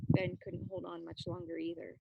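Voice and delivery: plain reading voice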